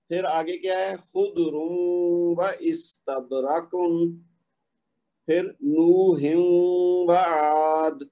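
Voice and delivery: قیاما و قعودا very slow, the tanween merged into waw; ادغام کے ساتھ; slow tajweed recitation. Shown as a demonstration. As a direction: recite each phrase with nasal merging. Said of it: "اسی طرح اگر آپ آگے دیکھیں".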